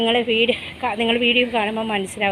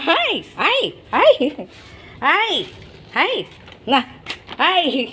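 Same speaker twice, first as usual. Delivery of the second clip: much higher, swooping hi-hi calls; high bouncy calls to a dog; excited greeting; 3 semitones higher, slower than usual. Real, not acted. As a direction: greet a happy dog, sing-song.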